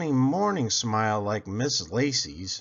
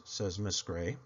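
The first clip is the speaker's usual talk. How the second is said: ordinary delivery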